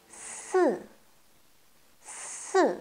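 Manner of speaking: « Ce » soufflé puis descendant; « Ce » en sifflement, ton qui tombe; si quatrième ton. Shown as a demonstration. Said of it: "Quatrième ton". (si au ton descendant)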